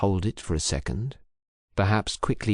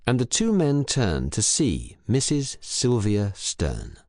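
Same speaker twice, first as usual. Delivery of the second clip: plain reading voice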